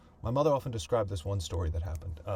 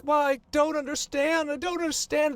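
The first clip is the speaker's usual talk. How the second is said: put-on much higher, louder child's protest; kids pleading; 6 dB louder than usual. Sisters running around the kitchen, high and bewildered. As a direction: cry out in confusion like a small girl. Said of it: "And they're like".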